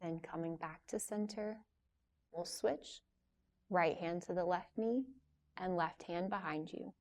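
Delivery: soft, unhurried yoga teacher voice with long pauses; guided yoga instruction. Real, not acted. Yoga teacher leading a class through gentle stretches before relaxation, calm guiding voice. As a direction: guide them softly through each stretch, leaving room to move.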